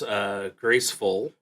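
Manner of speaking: normal talking voice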